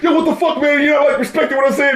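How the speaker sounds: high, fast, irritated complaint; mock the angry guys in the studio; annoyed protest. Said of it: "was like".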